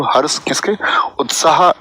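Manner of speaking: ordinary delivery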